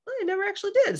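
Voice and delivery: high, small guilty confession; sheepish admission. Retold or imitated. Admitting to Matt she never told him, high and sheepish. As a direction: pipe up high, caught out. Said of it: "And then I was like".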